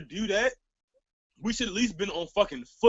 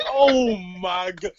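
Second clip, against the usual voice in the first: dismayed; 2 semitones lower, 8 dB louder, much slower than usual. Retold or imitated. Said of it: "And I'm just like".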